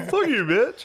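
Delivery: high, loud mock outburst; imitated screechy street-fight shout; mock angry yell. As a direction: hurl the insult, hammed up and pitched high. Friend imagines him cursing the officer.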